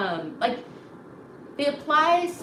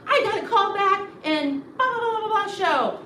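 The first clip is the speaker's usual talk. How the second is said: high, loud gushing brag; bragging excitedly; 9 semitones higher, 5 dB louder than usual. Retold or imitated. Student announcing a callback to the class, high excited gush. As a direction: gush about your big news. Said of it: "they'd be like".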